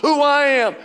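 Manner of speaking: fiery preaching. Acted. Pastor rallies the church about the Savior, fiery preaching. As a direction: drive it home to the congregation.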